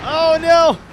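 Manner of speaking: sudden high, loud "oh no"; real dismay as the car spins; alarmed cry. Real, not acted.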